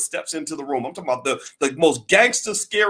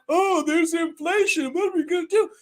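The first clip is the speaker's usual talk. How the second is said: sarcastic higher-pitched mock dismay; mock panicked Fed; 13 semitones higher than usual. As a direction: ham up clueless officials panicking. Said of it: "then they're like".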